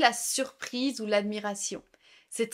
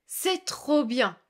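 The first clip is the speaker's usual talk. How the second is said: slow model phrase; 2 semitones higher, much slower than usual. Shown as a demonstration. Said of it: "tu peux dire".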